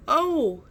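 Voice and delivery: high, loud pained groan. A narrator's reading. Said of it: "but much louder this time".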